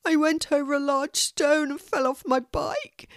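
put-on a small hurt boy, lightly whimpering; a touch of whimper in a little boy's voice. A narrator's reading. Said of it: "whimpers the boy".

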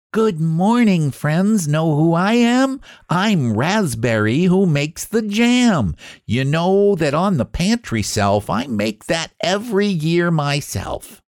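cheerful little fruit showing off; proud Raspberry. Acted. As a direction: boast about making the jam. Raspberry introduces herself proudly.

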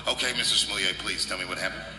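a bored veteran cop, voice dropped low; weary police officer. Retold or imitated. Veteran cop questioning the kid, low and patient. Cop taking a kid's report.

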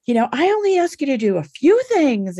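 exasperated lecture. Retold or imitated. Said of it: "it's like this whole, like, speech".

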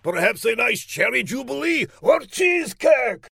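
high, pleading cartoon outburst; villain shouting. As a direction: protest in a high, hammed-up shout. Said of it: "shouted Laszlo".

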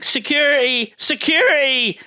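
imitating someone summoning the guards; calling for security. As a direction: call out in pretend alarm, pitched high. Acted newsreaders calling for security.

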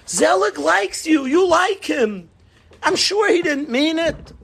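high-pitched, insistent reassurance; acted pious parent smoothing it over; answer-giving father. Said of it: "father, frum answer back".

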